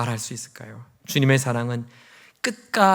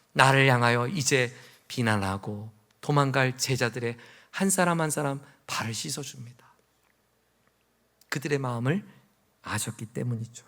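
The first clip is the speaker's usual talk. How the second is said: soft, tender sermon voice; quiet, reflective telling; hushed preaching; 4 dB quieter than usual. Real, not acted. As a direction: let it sink to a near hush.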